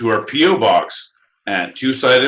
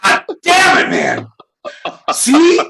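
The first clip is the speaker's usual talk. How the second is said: exasperated outburst; 10 semitones higher than usual. Real, not acted. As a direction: blow up in mock frustration.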